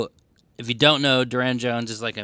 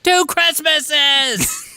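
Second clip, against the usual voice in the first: very high, loud, drawn-out squeal; mock-gleeful shout; 7 dB louder, much slower than usual. Co-host reacting to two Christmas albums, mock-gleeful shout. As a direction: shout it slowly like an overjoyed kid.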